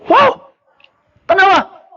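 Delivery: high, delighted wow; amazed wow. Real, not acted. Preacher to congregation, a high amazed wow.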